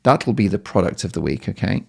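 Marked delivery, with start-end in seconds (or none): none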